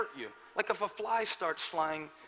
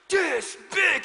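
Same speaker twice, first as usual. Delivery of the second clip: puffed-up "This big." said higher and louder; proud child; 9 semitones higher, 6 dB louder than usual. Retold or imitated. Son proudly showing his daddy's size, puffed-up boast. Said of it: "he got so proud".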